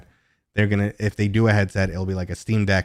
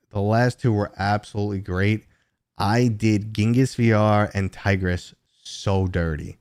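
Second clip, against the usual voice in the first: usual speaking voice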